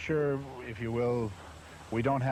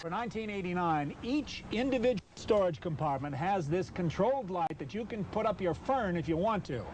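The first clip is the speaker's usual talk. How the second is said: upbeat pitchman read, a touch higher; 4 semitones higher than usual. Acted. Pitchman reading the car ad to camera, upbeat ad voice. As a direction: pitch the car's features.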